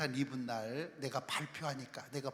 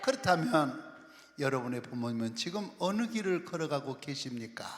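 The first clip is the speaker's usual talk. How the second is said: ordinary talk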